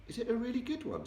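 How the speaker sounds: put-on high, quick little girl voice; eager child asking. Sophie eagerly asks the giant, in a high quick girl's voice.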